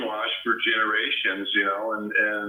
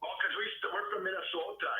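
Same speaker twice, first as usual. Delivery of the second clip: ordinary talk, nothing marked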